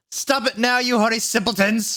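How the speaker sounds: high-pitched teacher's telling-off; indignant high cry; shouted scolding. A narrator's reading. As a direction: shout it like an outraged professor. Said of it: "shouted Professor McGonagall".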